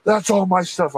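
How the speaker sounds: high-pitched retold protest; mock freak-out. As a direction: imitate someone flipping out, pitch jumping up. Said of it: "starts flipping the fuck out".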